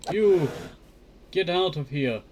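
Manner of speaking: angry demand. Real, not acted. Player ordering a mob away, loud and slow. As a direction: drive the mob off.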